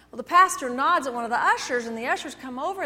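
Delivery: ordinary delivery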